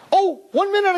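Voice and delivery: high-pitched sudden call; high, urgent interjection. Acted. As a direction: call out as if stopping someone. Calls his friends over.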